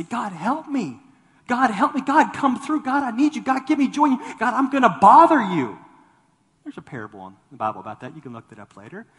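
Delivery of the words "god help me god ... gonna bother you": high, loud repeated pleas to God; fervent pleading prayer. Pretend. Preacher modeling persistent prayer, loud pleading. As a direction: cry out God help me over and over.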